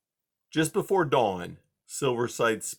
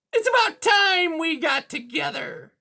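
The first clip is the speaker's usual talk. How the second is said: high, loud, sneering cat villain; high-pitched menacing sneer; 6 dB louder than usual. A narrator's reading. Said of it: "she sneered".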